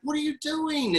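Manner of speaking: alarmed father yelling at his daughter; retold yell. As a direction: snap it out like a scared dad. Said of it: "And I yell".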